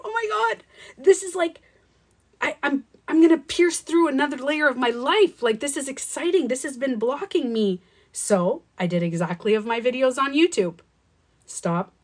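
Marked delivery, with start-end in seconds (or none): 0.0-7.8 high, excited realization. Retold or imitated. Woman retells discovering what had blocked her, excited. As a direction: burst out as if on a breakthrough. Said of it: "So I was excited".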